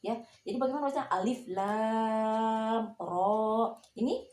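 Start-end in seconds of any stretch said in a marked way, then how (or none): 1.1-3.8 "Alif, lam, ro": long A, clean M, held "ro"; clear correct recitation. Shown as a demonstration. Teacher shows mim before ro read clearly, long level chant. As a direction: chant slowly with no lingering hum on "lam". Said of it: "dibaca te-, tegas".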